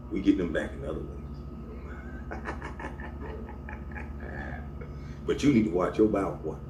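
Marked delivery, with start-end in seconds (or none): 2.4-5.2 low-volume breathy chuckle; long, quiet snicker. Real, not acted. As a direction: laugh softly to yourself, drawn out. Laughs after his own remark.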